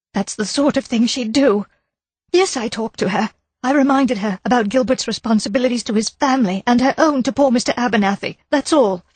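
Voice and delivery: insist, worried and defensive; nervous wife. A narrator's reading. Wife insisting to the inspector she saw nobody. Mrs Gower voiced higher.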